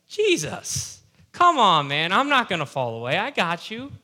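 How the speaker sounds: loud, high-swinging overconfident boast; cocky confidence. Acted. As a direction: act out a brash disciple reassuring his master. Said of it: "he was so confident in himself".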